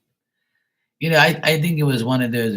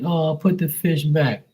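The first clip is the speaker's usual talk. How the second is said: a hint of a prissy voice; lightly higher mock of the squeamish; mildly mocking voice; mocking fish-releasers; 6 semitones higher than usual. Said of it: "They're like".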